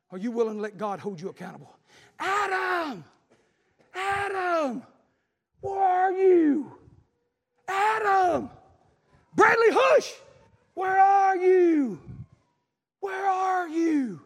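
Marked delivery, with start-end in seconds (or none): 2.2-14.2 high, slow pleading call, rising to a shout; God calling Adam. Acted. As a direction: call out to a lost son. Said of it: "He was calling".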